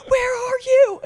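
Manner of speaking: very high, loud whine of confusion; panicked question. Retold or imitated. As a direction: squeal it like she's lost. Friend's confused reply on FaceTime.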